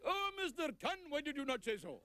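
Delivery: the clerk finally gets it and warms right up; delighted Indian clerk. Acted. Clerk suddenly recognizes him.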